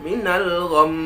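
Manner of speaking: "minal gham" loud, final m hummed long; "minal gham" closing on a long hum; held nasal ghunnah. Shown as a demonstration. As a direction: sustain the m ending "minal gham". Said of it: "ketika kita gunakan mim".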